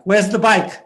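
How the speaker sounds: alarmed mother raising her voice; mom demanding. Retold or imitated. Mom's alarmed demand in story.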